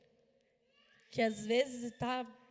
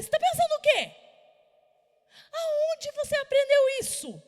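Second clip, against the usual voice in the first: loud, higher angry telling-off; angry scolding; 14 dB louder than usual. Retold or imitated. Mother storming in to scold her son for swearing, loud and angry. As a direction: confront him, furious. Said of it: "Já levantei, falei".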